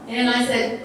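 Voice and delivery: ordinary talk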